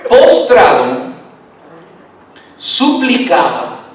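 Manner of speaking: postrado, suplicaba drawn out very slowly; slow emphasis. Shown as a demonstration. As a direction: lean on every syllable of postrado and suplicaba. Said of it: "chequen, esta parte es crucial".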